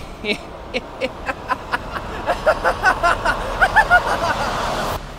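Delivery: high breathy villain cackle; evil laugh. Acted. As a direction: cackle like a madman. Villain laughs after song.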